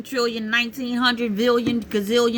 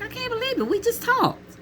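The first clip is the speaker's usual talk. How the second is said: much higher, softer, stunned; high softer disbelief. Retold or imitated. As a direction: say it up high and hushed. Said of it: "I'm looking like".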